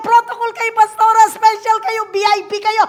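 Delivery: high, loud insistent usher calling out; shouting usher. Retold or imitated. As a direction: shout over the crowd to the pastor.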